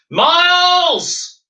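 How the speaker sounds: high, loud alarmed yell of his name; panicked parents bellowing for their son; parents shouting. Acted. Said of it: "they shouted".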